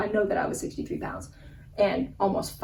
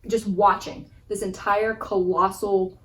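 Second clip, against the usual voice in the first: ordinary talk, nothing marked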